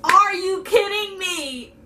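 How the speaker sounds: high, slow, incredulous; exasperated disbelief. Retold or imitated. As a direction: ask it like you cannot believe the noise. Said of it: "And I'm like".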